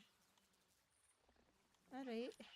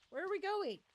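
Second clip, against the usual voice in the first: much higher, louder lost cry; excited outburst; 11 semitones higher, 9 dB louder than usual. Real, not acted. Lost player calling out to the map, high and loud. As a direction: blurt it out, bewildered.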